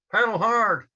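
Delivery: full-throated shout over roaring water; yelled. Retold or imitated. Storyteller shouting to April over the falls, loud urgent yell. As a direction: yell it hard at your partner. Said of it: "So I yelled to April".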